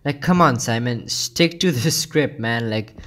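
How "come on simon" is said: ordinary talk, nothing marked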